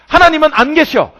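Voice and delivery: loud, high cry that God is absent; despairing outcry. Acted. Preacher voicing Paul's despair, loud and high. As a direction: cry it out as a man who thinks God has left him.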